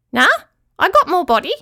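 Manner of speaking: high, loud little boast; squeaky, pleased-with-itself claw creature; proud squeaky creature. A narrator's reading. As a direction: call it out proudly in a tiny critter voice. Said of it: "called the voice proudly".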